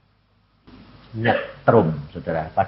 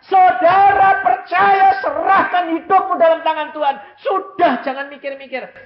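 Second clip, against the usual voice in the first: high, loud shouted exhortation; woman evangelist; 7 dB louder than usual. Retold or imitated. Woman evangelist urging the congregation to surrender, high loud shout. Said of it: "seorang penginjil perempuan yang dia berkata".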